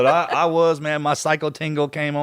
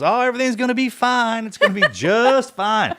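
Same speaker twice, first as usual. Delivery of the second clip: mock-reassuring mom; 9 semitones higher, 4 dB louder than usual. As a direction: play up the reassurance, pitched high, stretching the end. Mom's breezy reassurance, retold.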